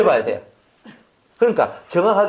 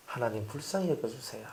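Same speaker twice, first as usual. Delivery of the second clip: much softer, hushed plea to God; quiet prayer; 3 semitones lower, 13 dB quieter than usual. Shown as a demonstration. Pastor shows a loving heart praying softly. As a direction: pray it under your breath. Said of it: "이렇게 나오는 거예요".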